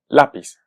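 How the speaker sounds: lápiz as LÁ-piz, a standalone model word; lápiz with first-syllable stress, falling away. Shown as a demonstration. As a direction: punch LÁ in "lápiz". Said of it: "escuchamos claramente que es lápiz".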